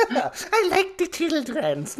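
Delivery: slow, high put-on voice, laughing; silly mock voice. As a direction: play it as a squeaky joke character.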